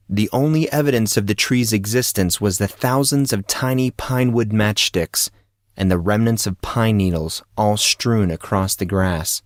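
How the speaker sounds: ordinary delivery, nothing marked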